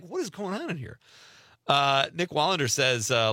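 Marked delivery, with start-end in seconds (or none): 0.0-1.0 put-on high, softer voice of a baffled kid, lightly done; bewildered kid. Host voicing himself as a kid at the trough, mildly bewildered. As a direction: lightly play a puzzled child. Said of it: "Like, you're a kid, you're just like".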